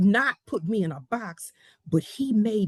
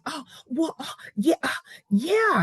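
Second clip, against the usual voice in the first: higher-pitched, flustered stops and starts; imitated stammering; 5 semitones higher than usual. Attorney imitating her flustered challenger, stammering. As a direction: mimic someone fumbling for an answer. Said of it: "he started stuttering and stammering".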